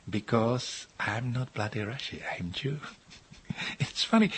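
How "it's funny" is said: breathy laugh running into the words, quick. Real, not acted. Amused at being called a bloody Russian, laughing.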